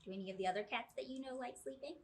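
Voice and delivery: ordinary delivery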